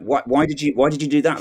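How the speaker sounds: normal talking voice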